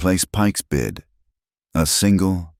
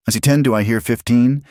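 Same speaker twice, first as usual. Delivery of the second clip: higher, louder bid call; auctioneer call; 8 semitones higher, 4 dB louder than usual. Acted. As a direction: rattle off bids like an auctioneer. Auctioneer calling bids.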